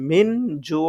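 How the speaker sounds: মিন with a plain n before জু; incorrect clear n. Shown as a demonstration. Teacher showing a possible mistake, মিন জু with plain n. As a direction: say মিন জু without humming the ng. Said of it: "তাহলে তার উচ্চারণ ভুল".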